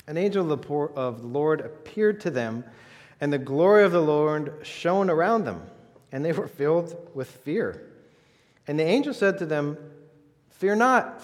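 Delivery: reading the angel's announcement aloud to the congregation, proclaiming; scripture reading. Acted. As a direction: proclaim the Bible passage to the room. Reads the nativity scripture aloud.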